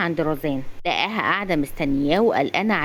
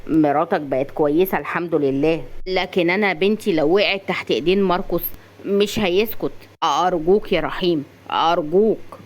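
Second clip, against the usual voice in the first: ordinary delivery, nothing marked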